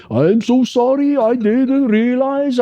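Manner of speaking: high, drawn-out mock apology; mock apologetic friend. Friend Johan voiced apologizing.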